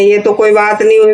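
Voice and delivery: normal talking voice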